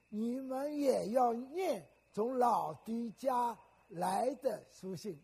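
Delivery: slow, loud solemn reading of the verse; scripture reading aloud. Acted. Preacher leads the congregation in reading the verse, slow and loud. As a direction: read it out slowly and loudly, word by word. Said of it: "请，我们读读看".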